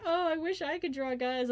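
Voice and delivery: high mock girly sigh; girly envious voice. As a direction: imitate the envious girls. Imitating girls' envious remark.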